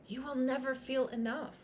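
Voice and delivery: ordinary talk, nothing marked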